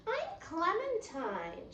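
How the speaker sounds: high, slow self-introduction; lightly voiced schoolgirl, patient; reminding girl. Classmate reminds Viv of her name, lightly voiced and high. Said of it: "she reminded me".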